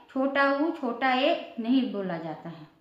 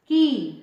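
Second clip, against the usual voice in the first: की said louder as the answer; emphatic answer; 5 dB louder than usual. Shown as a demonstration. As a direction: land firmly on की. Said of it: "का में बड़ी की मात्रा".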